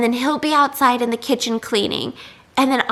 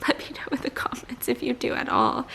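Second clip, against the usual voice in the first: soft whisper to the viewers; whispered aside; 8 dB quieter than usual. Real, not acted. Asking viewers to comment, in a whisper. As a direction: confide it in a hushed whisper.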